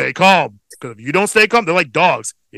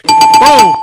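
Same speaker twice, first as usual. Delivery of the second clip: a triumphant, much louder boom; excited shout; 7 semitones higher, 10 dB louder than usual. Real, not acted. Telling his cohost about the hotel TV, joyful shout. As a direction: shout it like you just won.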